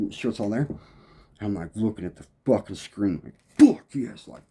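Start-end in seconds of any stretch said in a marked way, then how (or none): 3.6-4.3 loud, triumphant yes; excited. Retold or imitated. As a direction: punch it out like you just nailed the take. Excited at landing the recording.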